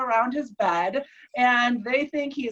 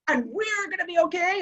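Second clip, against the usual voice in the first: higher, rising declaration; retold intensity; 6 semitones higher than usual. Patient assures wife they will both be okay, intense. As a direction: imitate someone earnestly announcing good news. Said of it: "he's super intimate".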